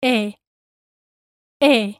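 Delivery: E held as a single pure vowel, no glide into I; Spanish pure E. Shown as a demonstration. Teacher modelling the Spanish E sound. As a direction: say E short and steady, Spanish style. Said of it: "Both E's in elegir sound like this".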